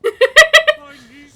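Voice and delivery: loud high burst of laughter. Real, not acted. Big laugh at remark.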